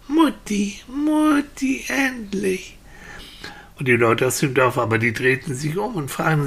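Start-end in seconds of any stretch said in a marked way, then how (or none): 0.1-2.8 put-on high, drawn-out childish cry; relieved little boy. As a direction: call to mother in happy relief. Boy reunited with his mother. (kleiner Junge, überglücklich und erleichtert)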